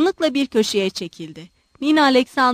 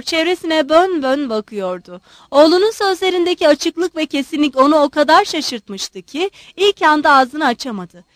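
ordinary delivery, nothing marked

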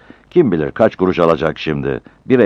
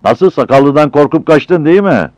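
loud, higher jeer; mocking taunt; 11 semitones higher, 8 dB louder than usual. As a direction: taunt him as the truck leaves. Driver's mate taunts from the truck. (alaycı, yüksek sesle takılma)

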